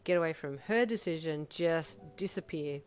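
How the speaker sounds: plain reading voice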